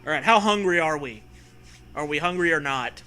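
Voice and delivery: ordinary talk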